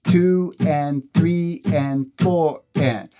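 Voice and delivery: slow, steady counting of the beat; slow counted rhythm. Shown as a demonstration. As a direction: count each beat slowly along with the strum. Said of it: "just so you hear the rhythm".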